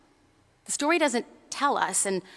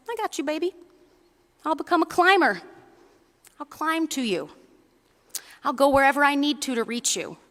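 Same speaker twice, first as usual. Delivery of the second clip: breezy mother bunny; 5 semitones higher, faster than usual. Acted. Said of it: "She says".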